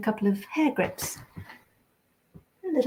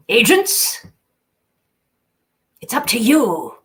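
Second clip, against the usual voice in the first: forceful call to the agents; [name]; 3 semitones higher, 6 dB louder than usual. Shown as a demonstration. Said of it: "is a bit more forceful".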